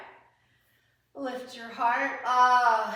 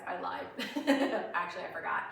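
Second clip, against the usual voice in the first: laughing; 4 semitones lower, faster than usual. Real, not acted. Admits forgetting a pose.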